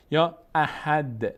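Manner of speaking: احد drawn out slowly with a clean stop; slow recitation. Shown as a demonstration. Teacher slowly recites احد for students.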